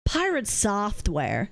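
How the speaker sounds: "Pirate software" drawn out slowly and low; low, slow demo of how "software" is said; contrasting pronunciation. Shown as a demonstration. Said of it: "Software versus software is different".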